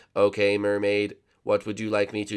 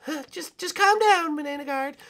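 soothing; 6 dB louder than usual. Acted. Soothing panicked Banana Guard.

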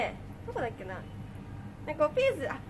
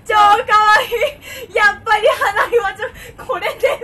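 loud, high otaku cheering; excited idol fan; 6 semitones higher, 12 dB louder than usual. Retold or imitated. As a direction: yell praise like a besotted fan at a concert. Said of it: "最後オタクが".

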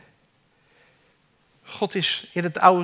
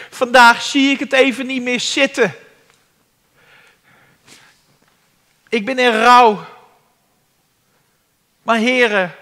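higher, louder fervent preaching; 5 semitones higher, 7 dB louder than usual. Acted. Preacher stirring the congregation, fervent preaching. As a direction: drive the point home to the flock.

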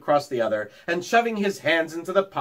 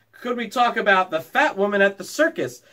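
lightly voiced boy begging, raised; 6 semitones higher, 4 dB louder than usual. A narrator's reading. Schoolboy begs the teacher to discuss the circus, mild pleading. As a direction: beg for the circus story. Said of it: "begged Isidore Feinberg reminiscently".

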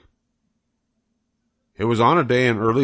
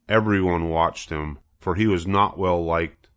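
ordinary delivery, nothing marked

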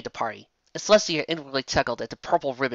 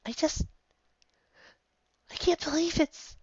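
tearful high voice; 5 dB quieter than usual. Acted. Tearful Celestia.

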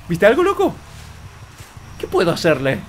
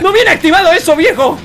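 high, raised protest; exasperated complaint; 8 semitones higher, 4 dB louder than usual. Real, not acted. Player complaining the drone is not activated, exasperated high protest. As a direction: protest in frustrated disbelief.

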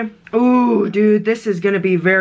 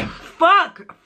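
high sharp cry of pain; pained yell; 10 semitones higher than usual. Real, not acted. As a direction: shout it as the burn hits. Mouth burning, yelling.

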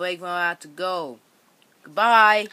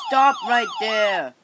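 commanding shout; 4 semitones higher, 7 dB louder than usual. Pretend. Police truck confronts the others.

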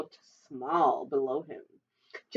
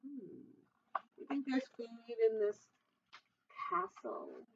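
hushed aside; 13 dB quieter than usual. Acted. Narrator's hushed aside to listener.

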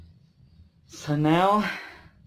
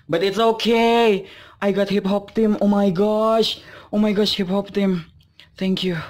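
higher, louder happy exclaiming; real excited gush, pitched up; thrilled relief; 6 semitones higher, 4 dB louder than usual. Real, not acted. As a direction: cheer up and call it out. Fan cheered by the pull.

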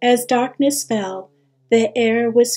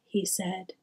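ordinary delivery, nothing marked

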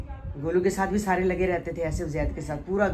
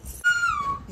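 ordinary talk

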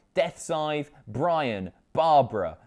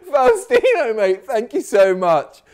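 thanks given high and loud, barely recovered from laughing; 9 semitones higher, 5 dB louder than usual. Real, not acted. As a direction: still giggly, trying to sound grateful. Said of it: "I'm, I'm crying".

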